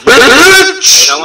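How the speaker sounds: loud punchline yell. Acted. Battle rapper finishing his verse with a shout. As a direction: bark the closer hard.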